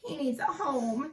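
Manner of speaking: lightly voiced baby; gentle high little voice; little child voice. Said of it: "said Baby Sue".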